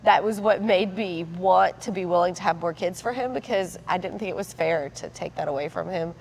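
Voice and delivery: usual speaking voice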